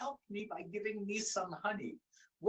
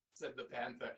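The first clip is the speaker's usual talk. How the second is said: usual speaking voice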